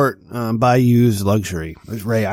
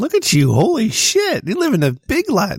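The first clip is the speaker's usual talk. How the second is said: excited; 12 semitones higher than usual. Real, not acted. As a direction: gush over a friend's fancy car. Mock awe at the Audi.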